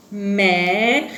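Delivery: "mercredi" loud, in three long separate beats; slow, projected syllables of "mercredi" with pauses. Shown as a demonstration. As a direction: sound out mer, cre, di in "mercredi". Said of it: "Alors, on redit".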